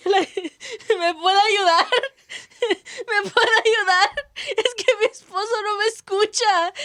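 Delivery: loud, high, sobbing plea in Spanish; crying plea. Retold or imitated. Begging a passing neighbor to help, crying plea. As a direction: beg for help through hammed-up tears. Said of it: "I'm literally crying".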